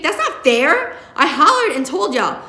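put-on high, loud teenage protest; shrill, outraged whine at a parent; shrieking. A narrator's reading. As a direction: shriek it in indignant complaint. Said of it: "I shriek".

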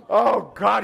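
high, loud frightened outburst; startled fright. Retold or imitated. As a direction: cry out as if jump-scared. Acts out his scare.

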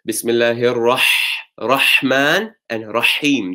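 "Rahman" and "Rahim" slow, with a pushed middle-throat ḥa; emphasised ḥa. Shown as a demonstration. Teacher demonstrating the middle-throat ḥa, slow and emphasised. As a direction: stretch "Rahman and Rahim", squeezing air in the throat on the ḥa. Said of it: "these are the ones that you put a bit more emphasis on".

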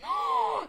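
very high, sharp gasp; shocked gasp. Real, not acted. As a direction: gasp high in sudden alarm. Sudden shock at the scene.

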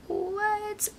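high, quiet, drawn-out what; mock-stunned, voice lifted and softened; retold disbelief. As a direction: let the word rise in disbelief. Acts out her shocked reaction.

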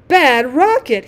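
indignant repeat. A narrator's reading.

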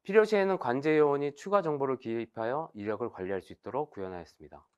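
ordinary talk

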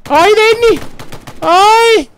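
very high, loud squeal; comic shriek. Acted. Streamer watching Denny, high-pitched mock shriek. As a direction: squeal his name in mock panic.

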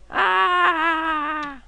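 high, loud pained shriek; sudden high cry of pain at a crash. Real, not acted. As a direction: yelp like you got hit. Character crash, real shout.

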